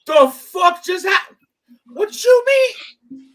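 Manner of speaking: high, drawn-out bewildered yelling; comically stunned, loud questioning; baffled outcry. Retold or imitated. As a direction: cry out slowly in disbelief, pitch climbing. Replaying his bewildered reaction outside.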